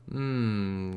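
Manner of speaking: ordinary delivery, nothing marked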